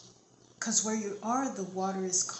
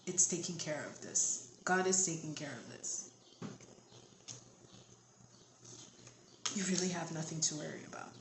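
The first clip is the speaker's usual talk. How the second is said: soft, low and soothing; quietly reassure, letting the voice sink low; hushed, comforting close; gentle reassurance; 3 semitones lower, 7 dB quieter, faster than usual. Real, not acted. Closing reassurance of the listener.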